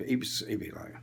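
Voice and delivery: normal talking voice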